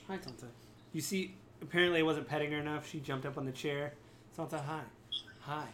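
quiet coaxing voice for a pet; soft pet talk. Real, not acted. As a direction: speak gently to the cat. Talking to her pet.

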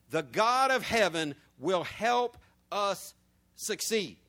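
slow, deliberate reading with "succeed" given its full weight; reading slowly. Shown as a demonstration. Teacher modelling careful reading for the class. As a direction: read it slowly and mind the punctuation. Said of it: "pay attention to the punctuation".

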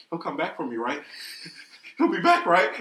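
high, hopeful pleading; waiting Charmander. Retold or imitated. Gamer likening himself to abandoned Charmander, hopeful pleading. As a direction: plead like a little creature left behind. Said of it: "like, holding a leaf".

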